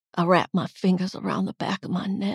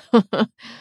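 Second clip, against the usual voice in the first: laugh; 3 semitones higher, 8 dB louder than usual. A narrator's reading. Aunt laughs at drunk girl.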